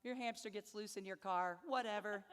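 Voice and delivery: normal talking voice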